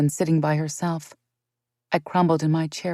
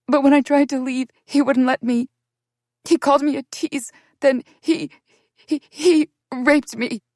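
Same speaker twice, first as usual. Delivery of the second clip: higher, louder, voice straining with distress; tearful confession; 8 semitones higher, 5 dB louder than usual. Acted. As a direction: push through the words as they break.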